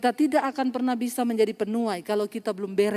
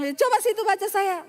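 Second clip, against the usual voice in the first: curt challenge; 9 semitones higher than usual. Retold or imitated. Voices the bitter, hostile woman.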